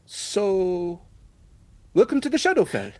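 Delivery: high, loud strained welcome through a forced grin; forced-smile greeting. Acted. Corvus greets the party with a high forced-smile welcome. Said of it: "forces a smile and goes".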